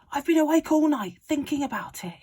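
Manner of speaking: high, loud, eager father voice; a dad bursting with a wild plan; excited father. A narrator's reading. Excited father telling his son he stayed up all night. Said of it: "he cried".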